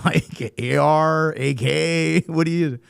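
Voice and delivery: high, loud, drawn-out guessing; acted incredulity. Retold questioning of hunters' weapons.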